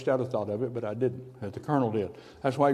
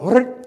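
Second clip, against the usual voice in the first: high loud mechanical whirr of a rifle; 9 semitones higher, 8 dB louder than usual. Retold or imitated. Imitating his jammed rifle firing for a church crowd. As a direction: make the gun's burst noise, high and loud.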